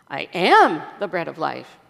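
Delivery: exaggerated emphasis on "am"; stress on AM. Shown as a demonstration. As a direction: hit the word "am" hard. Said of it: "emphasizing different words in the same sentence".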